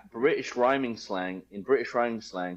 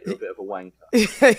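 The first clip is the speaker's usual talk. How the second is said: playful, louder ribbing with a laugh; jovial teasing; 8 dB louder than usual. Shown as a demonstration. Guest demonstrating British slang to host, jovial teasing. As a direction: tease like a mate, no sting. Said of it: "that's a jovial way".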